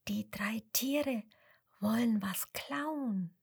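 slow, soft, voiceless whisper. Acted. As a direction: whisper the secret slowly. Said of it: "flüstert ihm ins Ohr". (langsam geflüstert)

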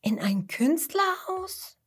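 high, slow, wondering question; puzzled Mama Koala, voice lifting high; astonished mother. Acted. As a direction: stretch it out in high-pitched surprise. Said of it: "schaut verwundert".

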